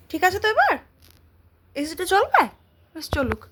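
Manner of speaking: high, loud cooing questions. Real, not acted. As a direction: chirp at a pet playfully.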